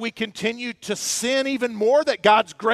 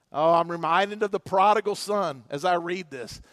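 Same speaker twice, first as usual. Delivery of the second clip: ordinary talk, nothing marked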